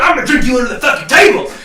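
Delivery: loud, high, fast challenge; aggressive boast. Acted. Giant to his drinking rival, aggressive shout. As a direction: fire the threat across the table. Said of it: "he looks at you and he's like".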